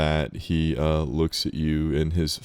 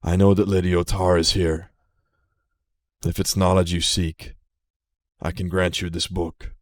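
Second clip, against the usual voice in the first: put-on proud, louder old dragon announcing himself; ancient dragon speaking; 6 dB louder than usual. The dragon Aragold introduces himself to Lily, proud and louder. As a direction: boom a little as the ancient gold dragon.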